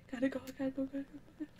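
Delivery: hushed rapid whisper; whispering while hiding. Real, not acted. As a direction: breathe it while sneaking away. Fleeing to hide from killer.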